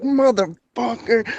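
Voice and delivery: high, drawn-out mock curse; imitated losing gamblers. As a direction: stretch the curse in mock dismay. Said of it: "They're like".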